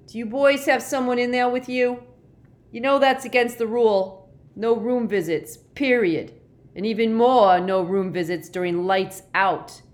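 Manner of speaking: loud, high-pitched scolding; officious, full-voiced telling-off; shouted reprimand. A narrator's reading. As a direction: bellow the rules through a closed door. Bullying monitor shouts through the door.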